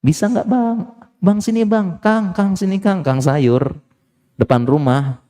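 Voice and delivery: high, sweet coaxing call; soft coaxing call. Retold or imitated. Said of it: "Panggilnya lembut".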